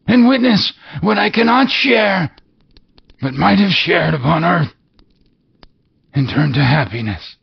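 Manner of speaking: high, wailing otherworldly voice; mournful ghost. Acted. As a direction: lament like a doomed spirit.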